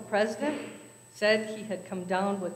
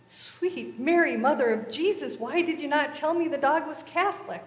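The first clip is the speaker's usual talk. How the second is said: a scandalized priest bursting out; exclaimed; 7 semitones higher, 4 dB louder than usual. A narrator's reading. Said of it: "Father Patrick exclaimed".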